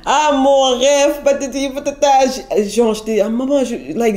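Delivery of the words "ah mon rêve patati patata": high, slow mock mum gushing; imitating African mum. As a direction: lightly play a delighted African mother. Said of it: "Vous connaissez les mamans africaines". (imitation d'une maman africaine ravie)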